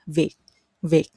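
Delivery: "Việt" ending almost like k. Shown as a demonstration. Teacher shows the Southern T ending, "Việt" closed like k. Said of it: "more like a K ending".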